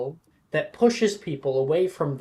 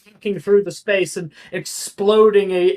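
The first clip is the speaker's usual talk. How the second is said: ordinary delivery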